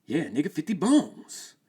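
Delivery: name the price with swagger; hustler voice. Acted.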